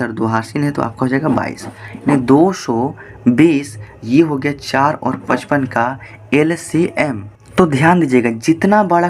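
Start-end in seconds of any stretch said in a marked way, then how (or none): 2.0-7.2 each word drawn out slowly for emphasis; slow, deliberate. Real, not acted. Teacher to students, stating the LCM answer, slow and deliberate. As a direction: spell out the answer slowly so it sinks in.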